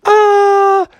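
high, breathy-free lilting princess. Acted. Princess telling Froggy about her sisters, high voice. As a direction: play the upset princess in a high voice. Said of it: "the princesses were like".

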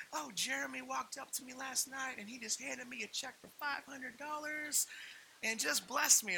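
high-pitched gushing impression; crazy church lady. Church lady telling pastor about the check, mock-gushing. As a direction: gush the news up in a high voice. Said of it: "this super crazy lady in our church".

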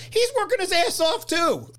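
high-pitched mock indignation; high, exasperated outburst; mock protest. Mock protest about film mechanic.

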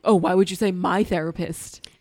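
mock arguing. Bill arguing back at Joe, mock offended. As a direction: draw it out, acting affronted. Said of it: "These two immediately start arguing".

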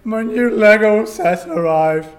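put-on high, loud eager kid voice; excited teen. Teen hero gushing to the Avengers. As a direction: gush like an overexcited teenager.

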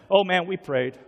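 a touch of given-up resignation; resigned. Acted. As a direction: say it like it was tried and failed. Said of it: "as if it's like".

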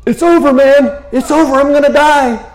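high, loud, hammed-up panic; mock despair. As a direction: cry it out as if doom is certain. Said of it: "his mind's like".

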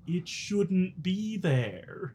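drawn-out and ominous; slowly, each word weighted; slow and insistent. Acted. Insistent character claim.

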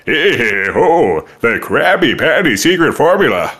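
swooping cartoon villain voice; exaggerated, sliding cartoon impression; Plankton. Shown as a demonstration. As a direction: put on a tiny schemer's cartoon voice. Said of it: "the Plankton voice".

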